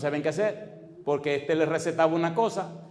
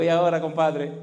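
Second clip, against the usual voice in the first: louder mock jab at the sick man; taunting; 3 semitones higher, 8 dB louder than usual. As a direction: rub it in, half laughing.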